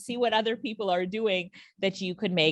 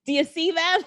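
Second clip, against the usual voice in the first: teasing question; 7 semitones higher than usual. Real, not acted. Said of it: "I'm just showing you the possibilities".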